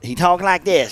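lightly mocking high country voice; a hint of a put-on Southern character; Crazy Bobby. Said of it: "we called him Crazy Bobby".

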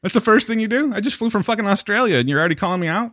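high-pitched hurt protest; indignant friend. Acted. Jet-lagged visitor being called out, indignant. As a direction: act affronted at the cold welcome. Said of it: "He's like".

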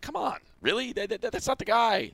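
high-pitched, exasperated disbelief; incredulous protest. Acted. Sports host scoffing at a postgame interview, incredulous protest. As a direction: protest it high, as if it's absurd.

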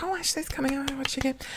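high, very soft eager wondering; whisper-like high aside, acting out the wait; hushed inner aside. Teller recalling waiting at the awards night, hushed high aside.

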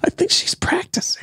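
whispered, voiceless aside; hushed whisper. Acted. Imagining the woman hiding in the closet, whispering. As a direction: play at whispering so nobody overhears.

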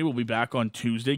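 ordinary talk

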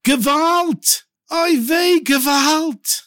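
high, wailing cries of Gewalt; joyful crying out. Acted. Owner cries out over his returned money, high and wailing. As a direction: cry out high in shocked joy. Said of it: "He starts crying, tears of joy".

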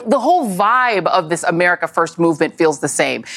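normal talking voice

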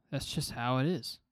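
ordinary talk

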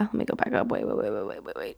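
fading to a whisper; quiet. Real, not acted. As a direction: trail off into a whisper. Trailing off while searching.

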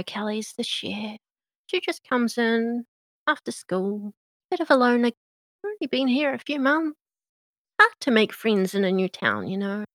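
high, bubbly, gossipy small-town waitress; rattling on brightly about the kitchen girl; chatty Meadow. Acted.

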